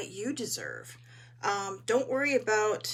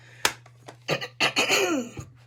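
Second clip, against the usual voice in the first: throat clearing; 10 semitones higher, 8 dB louder than usual. Real, not acted. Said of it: "I'm just losing my voice".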